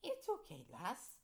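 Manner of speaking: put-on lightly done Irish accent, starting high. Ghoul reassures the teacher, Irish accent. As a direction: try an Irish lilt. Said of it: "said in an Irish accent".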